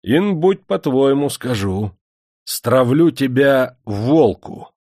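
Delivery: high, loud and slow menacing sentence; cruel verdict. A narrator's reading. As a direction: drag out the death sentence. Master pronounces cruel sentence.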